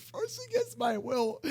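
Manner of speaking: laughing. Real, not acted. Laughing while dragged to dance.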